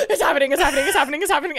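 high, loud giddy panic; re-enacted excited freak-out. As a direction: squeal it over and over, barely containing it. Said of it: "I'm, like, freaking out".

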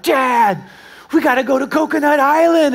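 put-on kids begging.

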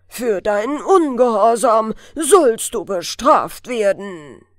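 loud, slow booming verdict from a giant. A narrator's reading. Fir spirit, grown huge, banishing the elf, booming loud. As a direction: thunder the banishment down on her. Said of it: "mit dröhnender Stimme rief er".

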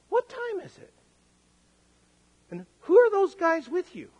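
high-pitched, incredulous wife voice; bewildered wife. Acted. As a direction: play a woken wife's mounting disbelief. Jailer's wife woken at night, voiced.

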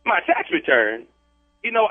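normal talking voice

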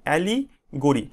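"Allegory" slowly split, ali - gori; broken into parts. Shown as a demonstration. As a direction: pause in the middle of "allegory". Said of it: "এইভাবে উচ্চারণ করবে". ("Allegory" ভেঙে ভেঙে ধীরে উচ্চারণ)

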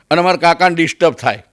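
ordinary talk, nothing marked

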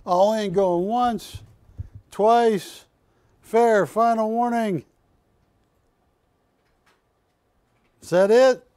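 building loud auction chant; auctioneer call. Pretend.

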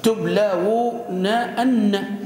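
syllable by syllable, ending تبلون أن on a doubled n; emphatic nun added. Shown as a demonstration. Teacher adds the emphatic nun, drawn-out تبلون أن with every n sounded. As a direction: spell out تبلون أن very slowly as tub-la-wu-naa-anna. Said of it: "دخل نون التوكيد".

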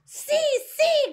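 high, loud excited shriek; shout. A narrator's reading. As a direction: yell it in triumph. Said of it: "gritó la primera bruja".

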